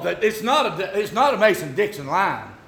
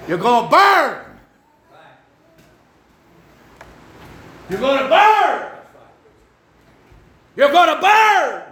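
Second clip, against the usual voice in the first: building from firm to a loud, slow shout; blunt warning; 9 semitones higher, 7 dB louder, slower than usual. Shown as a demonstration. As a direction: hammer "burn" harder each time. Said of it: "'cause that really hurts".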